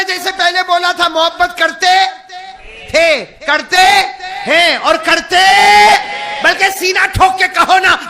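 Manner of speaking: loud, high call to the crowd; fervent loud sermon urging response; rousing preaching. Acted. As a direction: fire up the congregation.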